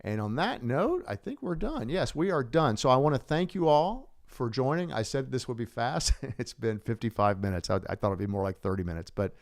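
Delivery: usual speaking voice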